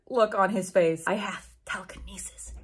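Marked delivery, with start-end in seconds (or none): 1.1-2.5 mock cruel. A narrator's reading. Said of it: "he answered cruelly".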